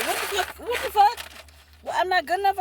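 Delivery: high, rising mock outrage that builds to a loud yell; mock outraged friend. Friend, hurt at not being her type, yelling in mock outrage.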